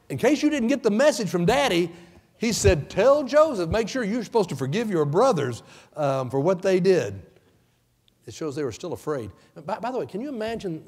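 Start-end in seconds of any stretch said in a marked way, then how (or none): 0.1-7.2 high, loud, sarcastic mimicry; mock their transparent trick; preacher mocking the brothers' ruse; sarcastic mockery.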